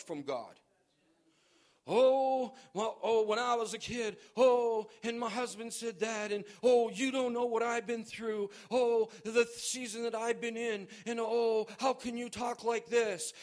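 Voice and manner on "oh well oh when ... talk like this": mock whining, higher and loud; whiny complainer. Preacher mocking a complaining congregant, whiny. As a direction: whine like a self-pitying believer. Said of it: "I don't care".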